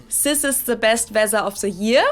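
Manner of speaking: "weather" with a buzzing z for th; German accent, "Sis" for this and "the" as "ze". Shown as a demonstration. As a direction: swap every th for s or z, as in "Sis". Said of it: "Or a Z sound".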